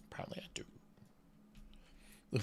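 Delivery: whispered wry aside; whispering. Real, not acted. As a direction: breathe it, barely voiced. Wry aside after admitting problem.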